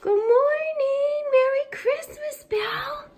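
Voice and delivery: high, slow sing-song greeting to a pet; talking to a dog. Real, not acted.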